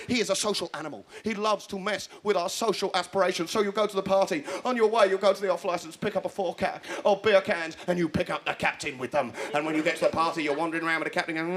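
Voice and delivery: ordinary talk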